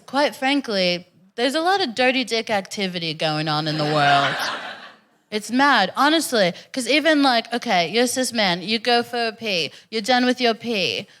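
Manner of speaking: ordinary talk, nothing marked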